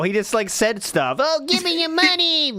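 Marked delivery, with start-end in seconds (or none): 1.2-2.5 high mocking beggar voice; mock Ralph voice. Co-host mocks a streamer, high hammed-up beggar voice. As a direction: ham up a greedy plea. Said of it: "He couldn't think of a joke at all".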